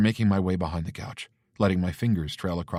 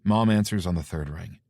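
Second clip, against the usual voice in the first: ordinary delivery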